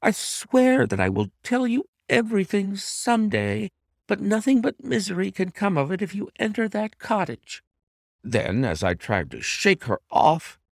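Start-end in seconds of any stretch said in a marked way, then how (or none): 0.0-7.6 high-pitched, earnest wife's plea; pleading wife. A narrator's reading. Wife begs husband not to enter.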